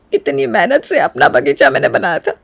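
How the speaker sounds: acted hurt, close to crying; tearful reproach. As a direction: mourn the garden you made. Grieving over her garden. (रुआँसी आवाज़ में शिकायत)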